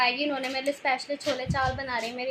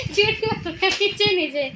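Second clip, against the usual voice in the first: mimicking mom; 6 semitones higher, 5 dB louder, slower than usual. Mimics mother's whispered protest.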